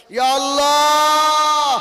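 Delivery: very loud, high, long-held call to God; full-mouthed cry. Shown as a demonstration. Preacher showing congregation how to call God, full-mouthed shout. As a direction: shout it with the whole mouth, stretching the last vowel. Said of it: "মুখ ভরে আল্লাহ বলেন না".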